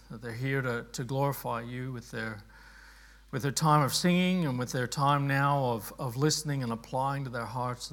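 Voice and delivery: low, soft praying voice; congregational prayer. Real, not acted. Pastor praying to Heavenly Father before the study, low quiet prayer.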